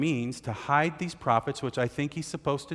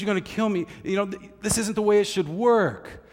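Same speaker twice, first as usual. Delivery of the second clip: higher, louder mock protest; whining complaint; 8 semitones higher, 4 dB louder than usual. Obadiah complaining to Elijah, mildly hammed-up. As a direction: complain as if wronged. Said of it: "And he says".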